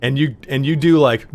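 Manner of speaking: ordinary delivery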